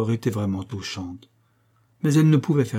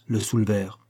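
ordinary delivery, nothing marked

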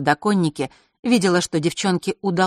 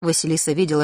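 ordinary delivery, nothing marked

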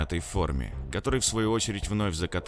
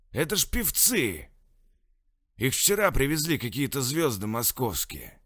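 put-on town drunk; 6 semitones higher than usual. Captain voice, drunk.

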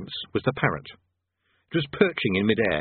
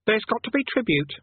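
put-on high boy voice, unbudging; flatly insistent; 12 semitones higher than usual. A narrator's reading. Boy Eric demanding tribute, high and insistent. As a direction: state it as a high-pitched demand. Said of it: "he said flatly".

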